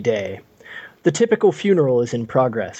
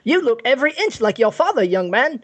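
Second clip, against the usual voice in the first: high, fast mock Cockney drifting to Michael Caine; a hammed-up Michael Caine; Cockney that slides into Michael Caine; 9 semitones higher than usual. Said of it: "It's like Michael Caine".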